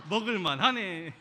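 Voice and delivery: "먹을만 하네" said slowly, loud, through a laugh; laughing repeat. Shown as a demonstration. Pastor re-enacting his reply to his wife, laughing. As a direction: laugh at your own gruff "먹을만 하네".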